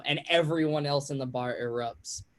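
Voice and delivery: normal talking voice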